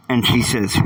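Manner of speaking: usual speaking voice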